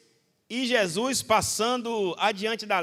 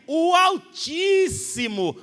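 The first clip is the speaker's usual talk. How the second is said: alto e arrastado, quase gritado; exclamação de louvor; 6 semitones higher, 4 dB louder, much slower than usual. Real, not acted. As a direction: proclame devagar, com força. Congregação exalta a Deus. (louvor gritado e arrastado)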